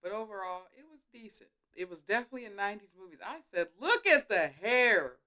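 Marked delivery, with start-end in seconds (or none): 3.8-5.2 drawn-out, louder, higher exclamation; exclaimed reaction. Retold or imitated. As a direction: call it out slowly in retold amazement. Retelling her reaction to nineties hair.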